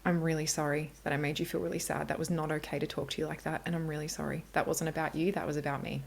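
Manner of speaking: put-on soft, gentle apology to a child; tender apology. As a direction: soothe and apologise to your daughter. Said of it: "I went back and I was like".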